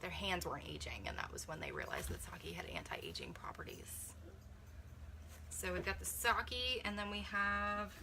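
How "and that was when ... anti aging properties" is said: soft, secretive whisper of a fun fact; whispered reveal. Real, not acted. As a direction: let it drop to a whisper like a secret. Revealing the sake discovery.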